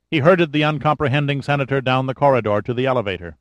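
plain reading voice

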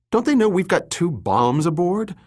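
high, indignant angry question; exasperated cursing. Acted. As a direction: fire it off pitched high. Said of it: "Summer cursed".

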